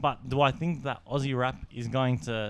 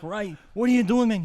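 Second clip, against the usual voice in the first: high, loud shocked yell at Ray; shocked outcry; 9 semitones higher, 6 dB louder than usual. Retold or imitated. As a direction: yell it in disbelief, pitched way up. Shocked at toothbrush use.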